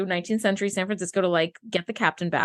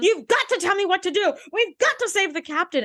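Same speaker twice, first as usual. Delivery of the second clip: mock shouting rant; 8 semitones higher than usual. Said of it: "high moral ground shouting rant".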